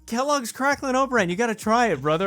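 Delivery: excited. Real, not acted. Insisting on favorite cereal.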